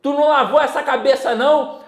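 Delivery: a mother fussing over her kid's dirty hair, mock scolding. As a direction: play the exasperated mother, half-laughing. Mother scolding about unwashed hair.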